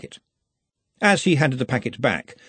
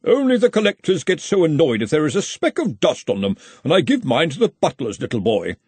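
blithely; 6 semitones higher, 3 dB louder than usual. Acted. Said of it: "Henry went on blithely".

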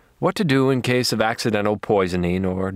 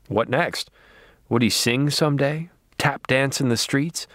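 ordinary delivery

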